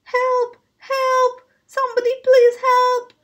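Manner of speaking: high-pitched storybook plea for help; cry for help. A narrator's reading. Ivy calling from the rock pile, high cry for help. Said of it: "Bradley heard a friend cry".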